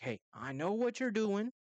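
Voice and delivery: high, drawn-out acted challenge; stern confrontation. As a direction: call her out, slowly and pointedly. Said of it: "give a stern talking to".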